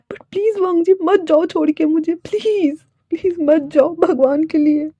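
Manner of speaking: high, insistent begging; desperate pleading. Acted. Fei begs, promising to protect him.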